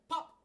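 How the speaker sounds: high, sudden popping sound; cork-like pop. Acted. Pooh bursts free of the hole with a pop. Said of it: "just as if a cork".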